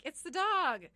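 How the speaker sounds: high, loud, drawn-out last word; insistent excuse. Retold or imitated. Woman blaming the dog for the smell, insistent.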